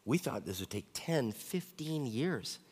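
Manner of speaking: soft, halting, imitating someone crying; quiet imitation of a tearful confession; retold tearful. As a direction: act lightly choked up, drawing out the last words. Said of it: "he's crying, and she's crying".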